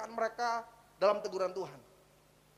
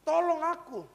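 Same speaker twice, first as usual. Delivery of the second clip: loud, raised cry for help; pleading prayer; 4 semitones higher, 6 dB louder, slower than usual. Acted. David crying to God for his dying child, pleading. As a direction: call out to God in mock desperation. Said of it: "Dia katakan".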